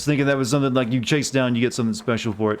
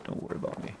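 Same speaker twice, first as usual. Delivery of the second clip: whispering; 14 dB quieter, faster than usual. Pretend. Blending in, hiding from guards.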